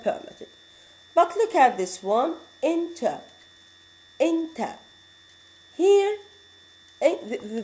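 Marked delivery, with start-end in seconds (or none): 2.6-4.8 enter stressed on EN, ter left weak; the teacher models enter for students, stress on EN. Shown as a demonstration. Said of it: "this vowel is not citrus".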